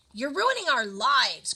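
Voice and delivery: a mild desperate outcry; crying out. A narrator's reading. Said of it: "cried the husband".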